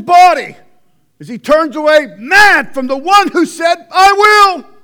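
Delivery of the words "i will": higher and louder ringing cry; proclaiming shout. Acted. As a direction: declare God's promise. God's healing promise proclaimed.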